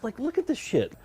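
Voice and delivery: high, marveling squeak; awed gushing. Real, not acted. Gushing over viewers' fan creations.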